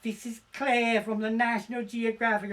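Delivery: hammed-up seductive woman on the phone. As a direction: purr it like a flirty caller.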